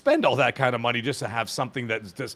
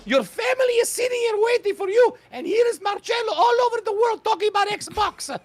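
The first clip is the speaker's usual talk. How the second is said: put-on Italian old woman. Mock Italian mother scolding Marcello for skipping dinner. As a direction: scold like an Italian grandmother calling her son home. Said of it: "your Italian old woman voice is not very good".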